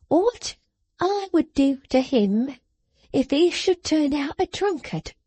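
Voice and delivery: high, sharp scolding voice; scolding little woman. A narrator's reading. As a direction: scold him like a child. Jenny's voice.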